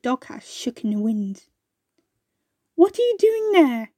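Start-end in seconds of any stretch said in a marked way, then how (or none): 2.7-3.9 high call from somewhere hidden. A narrator's reading. Hidden voice calling down to the two birds. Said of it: "they heard a voice call".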